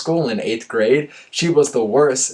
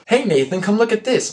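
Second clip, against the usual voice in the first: high, raised call across the room; calling over; 10 semitones higher than usual. A narrator's reading. Friend Aaron calling Nathan over to the window, raised voice. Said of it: "my friend Aaron called over".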